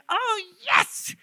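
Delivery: very high, drawn-out squeal of delight; acted-out thrilled cry; gleeful. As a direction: exult with a gleam in the eye. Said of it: "this gleam in his eye".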